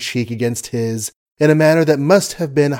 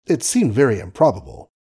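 ordinary delivery, nothing marked

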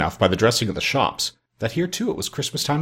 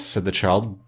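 plain reading voice

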